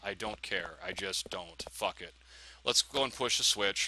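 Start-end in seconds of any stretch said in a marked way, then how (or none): 0.0-2.1 low, soft giving up; resigned. Real, not acted. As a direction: drop the voice in defeat. Gives up after repeated poisoning.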